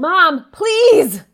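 loud, high exasperated shout; snappy tone. Acted. Daughter snapping at Mom through the bathroom door. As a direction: snap at her to stop. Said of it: "Did you hear that tone?".

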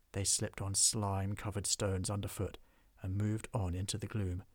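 plain reading voice

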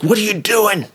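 high, whiny suspect voice; squeaky mock-indignant question; playing the irritated prisoner; mimicking the suspect. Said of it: "He's like".

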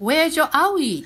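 put-on high-pitched doctor talking to a small child; imitated child-directed doctor question. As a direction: ask it high, as if to a little kid. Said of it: "ドクターが子供に向かって".

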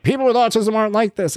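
high-pitched mock indignation; upset complainer. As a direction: imitate an offended objector.

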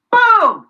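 high, loud shouted boom; punchy cartoon explosion; mock explosion boom. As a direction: blurt it like a blast going off. Chain of infection spreading.

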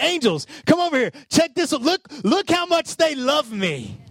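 high, eager, showing off; delighted boast. Pretend. Jesus calling angels to see his worshippers, delighted. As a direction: proudly call the angels over to look. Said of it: "He's like".

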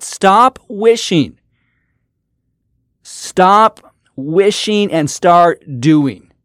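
slow drawn-out command. Real, not acted. Urging listeners to act.

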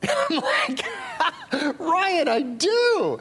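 laughing through the words, high and slow; half laughing in disbelief. Real, not acted. Pastor laughing to the congregation, slowed and amused.

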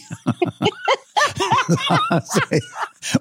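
high burst of laughter; high delighted laugh; big laugh. Real, not acted. Laughing at husband's story.

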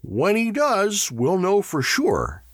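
high, slow, pointed announcement. A narrator's reading. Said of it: "he said loudly".

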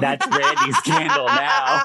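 high, laughing repeat; laughing echo. Acted. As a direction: squeal it back through laughter.

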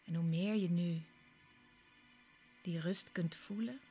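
usual speaking voice

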